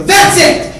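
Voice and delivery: high, very loud outburst; exploding yell. Retold or imitated. Man done with his defiant brother, yelling. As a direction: explode as patience runs out.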